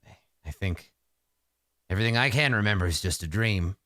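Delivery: lightly voiced sorcerer lost in low, halting reminiscence; rambling sorcerer. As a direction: drift through hazy memories, pausing often. Plays rambling old sorcerer.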